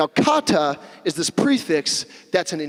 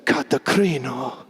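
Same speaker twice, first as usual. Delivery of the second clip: slow, lower mock-seductive Greek voice; sexy Greek voice; 4 semitones lower, slower than usual. Preacher likening the word to a Greek island, sexy. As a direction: draw it out like a sultry island name. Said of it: "if I say it in my sexy Greek voice".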